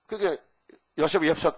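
usual speaking voice

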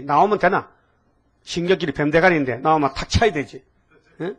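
normal talking voice

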